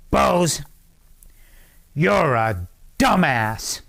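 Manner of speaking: slow, emphatic mock sergeant verdict; drill sergeant's insult. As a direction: imitate a sergeant, drawing out each word. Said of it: "and he says".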